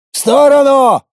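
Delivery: loud high bark; barked order. Acted. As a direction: bark the order over the fight. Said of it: "рявкнул я".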